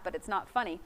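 ordinary talk, nothing marked

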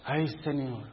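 a sigh of worry muttered under the breath; soft worried thought to himself; inner thought, hushed. Retold or imitated.

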